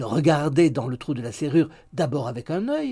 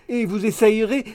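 high, raised peevish retort; annoyed; 8 semitones higher, 8 dB louder than usual. A narrator's reading. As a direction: snap back in mock vexation. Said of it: "dit Miggs d'un air agacé". (réplique aiguë, agacée)

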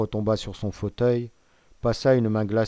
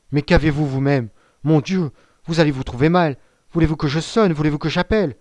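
higher, louder anxious questions; alarmed concern; 5 semitones higher, 7 dB louder than usual. A narrator's reading. Prisoner to a magistrate about to faint, alarmed. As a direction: rush to his aid, voice raised in concern.

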